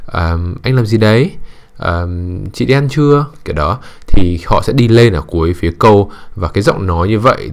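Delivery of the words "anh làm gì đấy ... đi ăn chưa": "Anh làm gì đấy?" and "chị đi ăn chưa?" each pitched up on the last word; upspeak at sentence ends. Shown as a demonstration. Showing how girls tend to talk, rising at each sentence end. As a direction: end every question on a high, rising lilt. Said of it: "họ hay có cái thói quen đi lên ở phía cuối câu".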